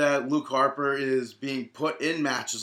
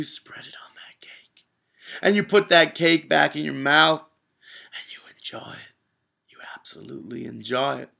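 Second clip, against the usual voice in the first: intense dramatic whisper; 2 semitones higher, 4 dB louder than usual. Pretend. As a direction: whisper it like a savoring secret. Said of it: "cut a little promo for us real quick".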